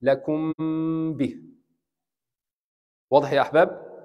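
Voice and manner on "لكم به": لكم به with the m held two counts; a short nasal hold on the m of لكم; ikhfa two counts. Shown as a demonstration. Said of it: "two counts". (لكم به بغنة حركتين)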